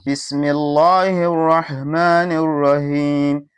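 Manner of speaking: slow chanted Quran recitation with mistakes; wrong recitation. Shown as a demonstration. Teacher demonstrating a mistaken recitation, slow chant. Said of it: "This is very wrong".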